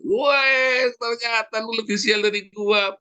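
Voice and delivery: high, slow smug gloat; gloating loser. Retold or imitated. A failed friend gloats to another, in a high smug voice. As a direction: crow as if worse luck is a prize.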